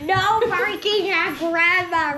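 loud, high scolding shout; yelling at the dog. Real, not acted. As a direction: yell at the dog to stop. Child yelling at the barking dog.

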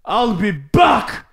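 slow mock Austrian accent; Schwarzenegger. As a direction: do a heavy Austrian action-hero accent. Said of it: "sound like Schwarzenegger".

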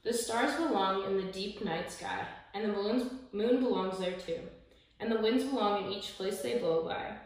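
ordinary delivery, nothing marked

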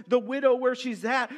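normal talking voice

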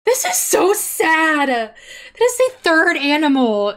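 loud, slow, dragged-out lament; upset lament. Real, not acted. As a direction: wail it in dismay. Lamenting the dead animal.

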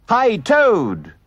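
loud high call across the road. Acted. Rat and mole calling to Toad, loud. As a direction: hail him from a distance. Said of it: "they cried".

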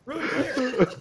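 loud, high tactical callout; mock SWAT shout. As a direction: bellow the all-clear in play. Mock SWAT room clearing.

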